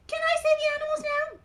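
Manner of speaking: eager, loud high puppet voice; Mimi. Acted. Mimi asks to name the animals, eager high puppet voice. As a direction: plead for a turn as a tiny character.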